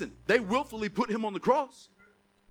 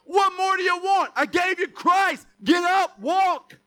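high, loud, exasperated shout; booming impatient God shouting; God's voice; 8 semitones higher, 9 dB louder, slower than usual. Retold or imitated. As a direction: bellow it like God fed up. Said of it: "God's like".

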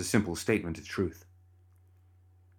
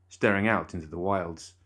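plain reading voice

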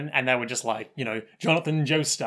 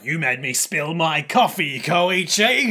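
angry outburst; 3 semitones higher, 4 dB louder, slower than usual. Retold or imitated. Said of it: "she's like".

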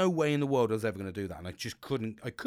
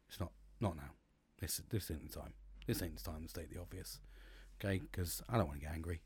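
much softer, low, holding back anger; low, restrained warning; 7 semitones lower, 13 dB quieter than usual. Retold or imitated. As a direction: keep the lid on, quiet and low. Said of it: "I looked at her and I says".

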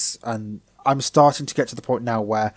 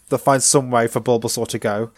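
ordinary talk

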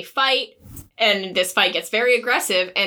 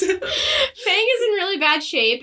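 laughing through the words; can't keep a straight face saying it; giggling, high, slow; 10 semitones higher than usual. Real, not acted. Laughing while recapping grim plot.

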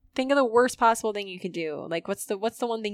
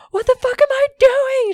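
much higher, louder mock scream; imitated scream; 12 dB louder than usual. The girl in the Instagram audio screaming in her car. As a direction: shriek it like a meltdown in the car. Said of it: "screaming in her car".

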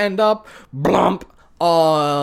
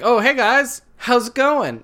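lightly voiced cheery hero, higher; breezy dude greeting; mock Hercules; 7 semitones higher than usual. Imitating Hercules greeting the heroes, breezy and cheery. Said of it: "Hercules is like".

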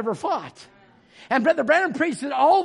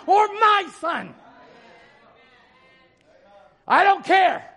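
preaching; 8 semitones higher than usual. Acted. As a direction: preach it with full force. Preaching at full force.

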